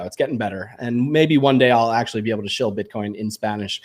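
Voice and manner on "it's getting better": soft, trailing-off repeat; quiet. Real, not acted. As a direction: say it quietly to yourself. Repeats it to himself.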